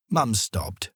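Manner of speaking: plain reading voice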